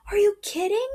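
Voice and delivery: high, loud excited whisper-cry; exclaiming. Acted. Kay reacting to her mom's offer, excited exclaim. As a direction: burst out in disbelief. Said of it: "Kay exclaims".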